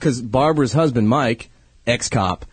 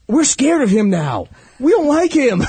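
put-on raised, higher-pitched voice of protesting kids; mock scared kids; 11 semitones higher, 4 dB louder than usual. Dad quoting his kids about the scary mustache, mock scared. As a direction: play children loudly refusing, voice pitched up.

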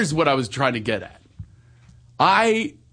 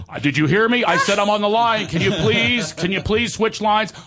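higher-pitched, exasperated phone caller; mock growing irritation; 6 semitones higher than usual. Caller fed up with the man on his line, mock exasperation. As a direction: build the pleading annoyance as you go. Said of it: "this guy is getting angrier and angrier".

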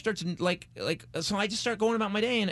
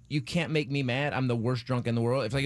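normal talking voice

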